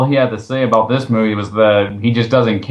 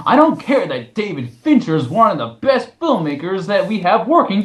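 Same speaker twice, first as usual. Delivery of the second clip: mocking critic voice; 7 semitones higher than usual. Host reading the critic's review aloud, mocking whiny voice.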